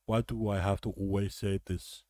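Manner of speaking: a hint of Schwarzenegger doing Klopp; mild mock Austrian accent for an annoyed manager; Arnold Schwarzenegger voice. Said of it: "I made him sound like Arnold Schwarzenegger".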